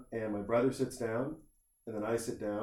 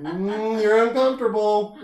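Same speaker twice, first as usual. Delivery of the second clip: teasing singsong; 9 semitones higher, 9 dB louder than usual. Retold or imitated. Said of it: "he's just like".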